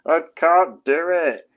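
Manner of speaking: slow, high, loud mock country accent; failed Archers accent. Streamer admits defeat in the Archers voice, mock rural accent. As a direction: drawl it in a badly faked rural English accent. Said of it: "I can't do it now".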